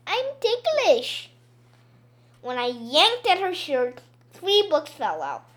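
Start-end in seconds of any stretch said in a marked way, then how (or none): 0.0-1.3 high, slow, ticklish voice; giggly. A narrator's reading. Missus Roopy as her shirt gets yanked, giggly. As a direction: sing it out as if tickled. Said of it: "Missus Roopy giggled".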